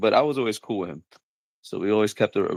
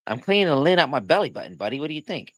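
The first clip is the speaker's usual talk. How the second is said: mock-indignant buddy, pitched up; Henry; 5 semitones higher than usual. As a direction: lightly imitate someone defending himself as if it's obvious. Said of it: "He's like".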